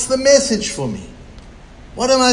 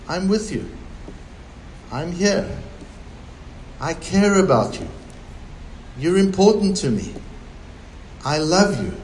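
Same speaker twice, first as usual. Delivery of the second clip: tender voice of God; 5 dB quieter than usual. Acted. God reassures his people, soft and tender. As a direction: speak each phrase like a loving promise. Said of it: "Listen to the warmth and the depth and the mellowness".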